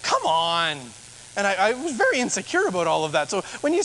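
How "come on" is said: low, drawn-out snarky jab; a lower, mock-snide imitation. Churchgoer seeming to jeer at a new preacher, snarky. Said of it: "like, being snarky".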